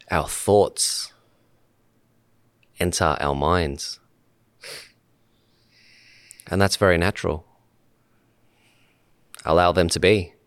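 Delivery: calm meditation leader, long pauses between short phrases; unhurried guidance, letting each phrase settle in silence; guided meditation. Real, not acted. Host guiding podcast guests to sit and breathe, calm meditation voice.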